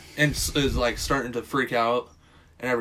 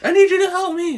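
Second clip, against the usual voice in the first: very high, loud pleading whine; mock desperate plea; 8 dB louder, faster than usual. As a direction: beg like a panicked girl, hammed-up. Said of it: "she's like".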